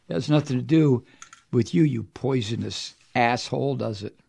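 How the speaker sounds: normal talking voice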